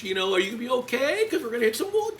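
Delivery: loud, very high sing-song taunt; mocking concern. Coach imitates colleague making fun of her condition, mocking. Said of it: "trying to make fun of me".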